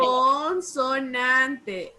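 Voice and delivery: CONSONANTE stretched out very slowly; emphatic correction. Shown as a demonstration. Teacher correcting a pupil's answer, slow and emphatic. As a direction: draw out "Consonante" syllable by syllable.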